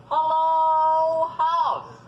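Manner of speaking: loud high call across the yard. A narrator's reading. Fox calling to the house, loud high call. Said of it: "and called".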